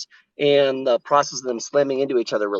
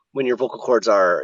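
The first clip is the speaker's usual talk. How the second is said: usual speaking voice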